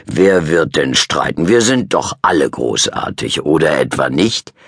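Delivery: smooth over the quarrel; dad calming. A narrator's reading.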